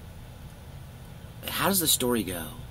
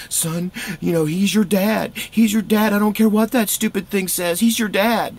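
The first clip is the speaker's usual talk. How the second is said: high, pleading mother insisting he's his dad; mother reassuring her son, high and emphatic; mom's voice; 11 semitones higher, 7 dB louder than usual. Retold or imitated. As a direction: voice the upset mom high and loud. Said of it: "She's like".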